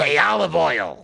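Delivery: loud, slow cartoon sailor call; Popeye. Dad calling to the cartoon sweetheart, Popeye impression. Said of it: "I sound like him, too".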